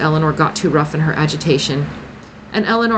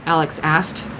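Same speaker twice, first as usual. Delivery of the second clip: usual speaking voice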